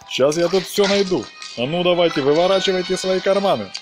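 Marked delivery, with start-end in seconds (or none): none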